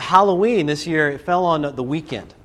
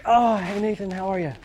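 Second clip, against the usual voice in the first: mock sweet greeting; 5 semitones higher than usual. Acts fake friendly greeting.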